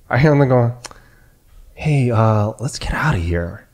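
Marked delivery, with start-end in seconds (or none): 1.7-3.7 imitating a casual, unhushed remark; normal voice, not whispering. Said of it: "said it in a normal voice, not like whispering".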